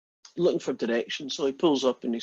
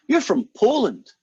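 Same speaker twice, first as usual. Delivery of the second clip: lightly voiced farmer, slow and higher; a touch of drawn-out, high-pitched farmer; 8 semitones higher, 3 dB louder than usual. Joke's farmer guessing the man's country, lightly acted and drawn out. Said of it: "And the farmer says".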